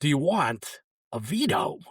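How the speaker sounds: slow, oddly paced Walken-style phone greeting; lightly put-on Walken voice, slow and halting; Christopher Walken impression. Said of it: "Answer the phone, it's Christopher Walken".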